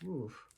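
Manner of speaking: soft oof. Real, not acted. Reader's reaction to crash.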